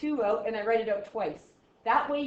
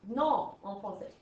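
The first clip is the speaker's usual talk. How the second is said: normal talking voice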